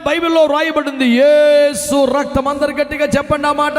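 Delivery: call-and-response sermon cry; preaching. Acted. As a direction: rouse the congregation. Calling congregation to respond.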